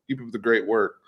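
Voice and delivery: normal talking voice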